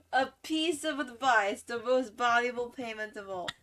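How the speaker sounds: mocking repeat. Streamer mocks the customer's advice, loud and sarcastic. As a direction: parrot her pompous line back to mock it.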